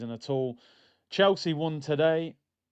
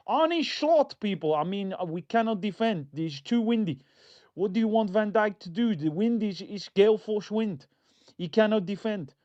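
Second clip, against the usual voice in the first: put-on Arne Slot Dutch accent; 4 semitones higher than usual. Mocking Arne Slot blaming the wind, in a hammed-up Dutch accent. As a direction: put on a hammy Dutch accent, lightly slurred into Birmingham. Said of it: "That was my Dutch accent".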